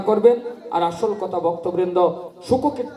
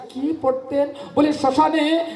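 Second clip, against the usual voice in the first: higher, slow preaching at full force; impassioned preaching; 8 semitones higher, slower than usual. Acted. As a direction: lift the story into a fervent slow climax. Preaching climax on Haridas's renunciation. (উচ্চ স্বরে ধীর আবেগময় প্রবচন)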